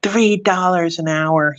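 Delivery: lightly acted angry customer; mock Karen. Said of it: "she starts, like, going".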